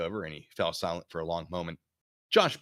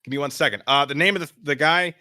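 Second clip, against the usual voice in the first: ordinary talk, nothing marked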